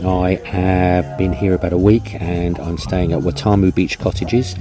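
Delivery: ordinary talk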